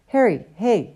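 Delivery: a hailing call with a rising end; first call to catch his attention. Acted. Sloper calls after Harry, raising his voice. Calling to Harry across corridor.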